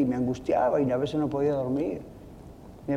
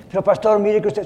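raised, louder anxious protest; a churchgoer objecting to the pastor, anxious and louder; worried churchgoer; 6 semitones higher, 5 dB louder than usual. Acted. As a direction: cut in like a fretful listener.

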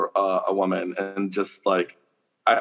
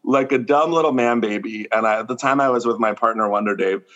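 ordinary talk

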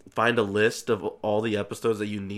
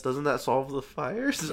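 ordinary talk

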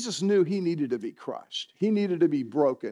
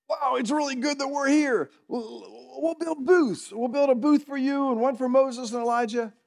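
higher, excited blurt; hammed-up eager Peter pitch; 6 semitones higher than usual. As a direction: gush the idea like an eager kid. Peter's eager idea, retold.

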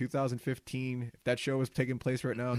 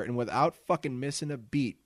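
ordinary delivery

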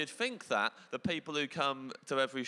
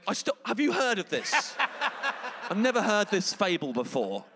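high, loud laughing disbelief; laughing lady; 9 semitones higher, 6 dB louder than usual. Retold or imitated. Lady laughing to her mates in the front row, laughing disbelief. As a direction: giggle through it to her friends. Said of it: "kept turning to her mates going".

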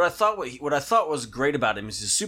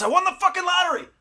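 high, louder mock yell; mock shout; 4 dB louder, faster than usual. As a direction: yell it like a lottery win. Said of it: "screaming at the top".

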